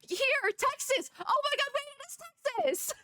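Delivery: very high, thrilled squeal; excited discovery. Real, not acted. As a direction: squeal as the realization hits.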